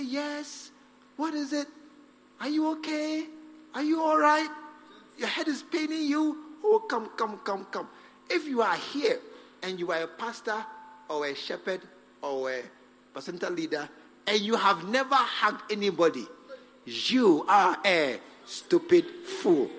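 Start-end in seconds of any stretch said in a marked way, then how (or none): 0.0-7.9 caring pastor. Acted. Ideal pastor to a troubled visitor, gently caring. 16.8-19.7 slow, deliberate "stupid fool" with weight on each word; stupid fool underlined. Real, not acted. Preacher scolding pastors, slow stressed "stupid fool". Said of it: "Please underline stupid and fool".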